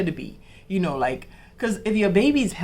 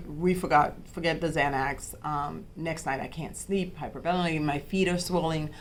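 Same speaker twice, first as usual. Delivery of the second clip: ordinary delivery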